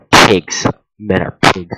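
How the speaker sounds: very loud, sudden outburst; exaggerated yelling; mock outraged shout. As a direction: blast the insult out in mock outrage. Outburst while reading the post.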